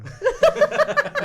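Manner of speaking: loud high helpless laugh; real laughing fit; burst of laughter. Real, not acted. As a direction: crack up out loud at the memory. Recalling cousin spitting water.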